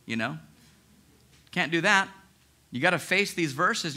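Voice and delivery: normal talking voice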